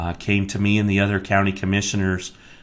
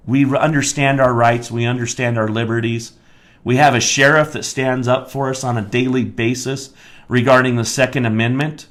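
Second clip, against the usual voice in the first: ordinary talk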